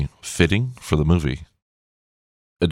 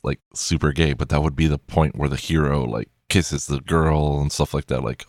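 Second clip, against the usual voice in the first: normal talking voice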